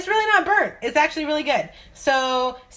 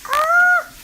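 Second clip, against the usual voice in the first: sudden high shriek; 8 dB louder than usual. Real, not acted. Sudden outburst at video end.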